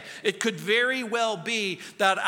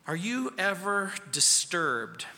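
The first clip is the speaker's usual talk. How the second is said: ordinary talk, nothing marked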